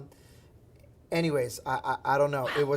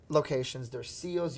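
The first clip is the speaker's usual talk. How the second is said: normal talking voice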